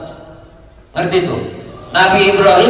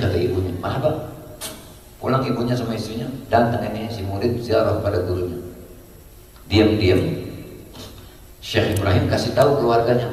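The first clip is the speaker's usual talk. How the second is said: low, soft, solemn storytelling; hushed story narration; 5 semitones lower, 8 dB quieter than usual. A narrator's reading. Preacher telling congregation of a disciple's family reunion, hushed narration. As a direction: tell it quietly, in a lowered voice.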